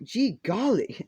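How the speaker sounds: put-on old-timey exclamation. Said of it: "how eighteen hundred people will talk".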